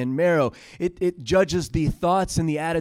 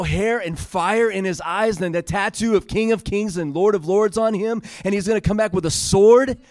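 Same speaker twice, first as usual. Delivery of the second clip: excited; 5 semitones higher, 4 dB louder than usual. Retold or imitated. Retelling his talk to his boys.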